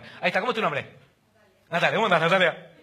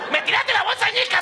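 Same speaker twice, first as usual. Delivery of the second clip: much higher squeaky put-on woman's voice; mock offended woman; 10 semitones higher, 3 dB louder than usual. Woman scolding the man for throwing her bag, high mock voice. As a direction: squeal it like she's scandalised.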